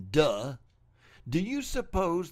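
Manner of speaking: a loud, mocking "Duh"; scornful duh. Mocking evolution mythologists to the audience, loud sarcastic scorn. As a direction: blurt it out like it's obvious.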